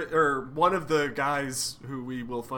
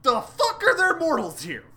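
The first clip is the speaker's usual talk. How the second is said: much higher, louder indignant shout; outraged yell; 6 dB louder than usual. Acted. Odin finding mortals in his house, outraged shout. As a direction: blow up at the intruders. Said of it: "Odin turns around and goes".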